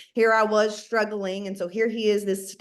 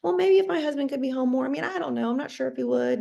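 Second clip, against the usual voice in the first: hesitant excuse; 5 semitones higher, faster than usual. Retold or imitated. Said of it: "So we say things like".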